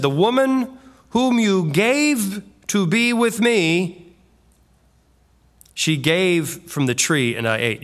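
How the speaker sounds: somewhat defiant. Acted. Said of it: "kind of how I just read it even somewhat".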